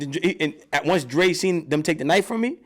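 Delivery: ordinary delivery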